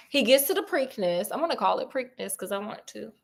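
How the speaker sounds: normal talking voice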